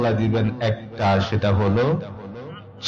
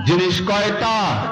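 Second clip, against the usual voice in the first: insistent prompting; 10 semitones higher than usual. Real, not acted. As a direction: call it out for the crowd to answer. Demands an answer from audience.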